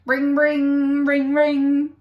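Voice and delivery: phone ring imitation.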